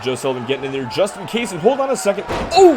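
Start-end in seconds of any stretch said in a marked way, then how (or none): 2.3-2.7 sudden high, loud gasp of shock; surprised. Real, not acted. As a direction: shout the surprise. Shocked reaction to backbreaker.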